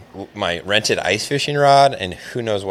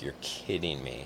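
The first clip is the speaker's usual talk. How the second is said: quiet disbelief; 6 semitones lower, 16 dB quieter than usual. Retold or imitated. Retold disbelief as fish bites.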